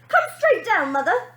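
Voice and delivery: very high, loud demand; girl demanding her mother come. Acted. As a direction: demand it bossily. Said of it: "demanded the girl".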